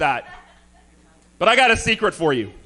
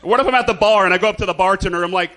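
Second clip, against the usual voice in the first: ordinary talk, nothing marked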